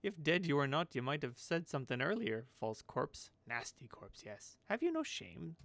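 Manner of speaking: character voice, outraged and swooping; eccentric seamstress, startled. Acted. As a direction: scold like a batty old crone. Voices startled Marta scolding corpse.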